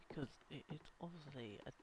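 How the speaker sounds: hushed whisper aside. Real, not acted. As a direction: whisper it like a secret. Whispered aside about death trap.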